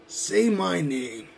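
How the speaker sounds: very slow say my name; slow menacing. Acted. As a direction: drag out say my name with each word held. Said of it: "I'm gonna make you say my name".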